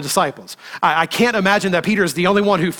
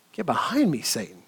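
hushed repeat; 4 semitones lower, 7 dB quieter than usual. Acted. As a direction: repeat it much softer, sinking away low. Repeated rebuke, dropped much softer.

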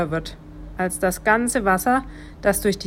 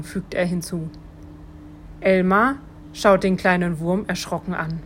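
usual speaking voice

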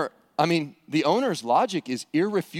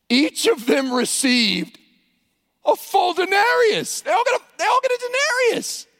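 amazed; 12 semitones higher, 3 dB louder than usual. Acted. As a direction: sell the shock of full pay. Preacher dramatizes the surprise payout.

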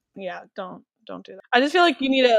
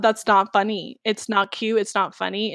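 usual speaking voice

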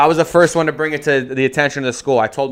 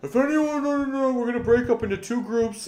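much higher mock professor cutting in; professor yelling over; 12 semitones higher, 5 dB quieter than usual. Professor talking over the student's question, high mock voice. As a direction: squeak over him to drown him out. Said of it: "starts yelling over me".

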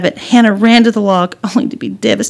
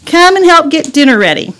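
high, louder summons; motherly call; 9 semitones higher, 5 dB louder than usual. Acted. Mom summoning daughter to help, calling out. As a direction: call a child in to dinner.

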